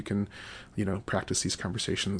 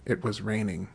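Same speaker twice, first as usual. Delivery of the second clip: ordinary talk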